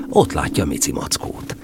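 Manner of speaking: ordinary delivery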